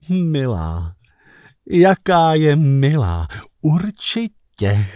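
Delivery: slow, dreamy murmur; smitten murmur. Retold or imitated. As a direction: savour her words tenderly. (zasněné něžné zamumlání)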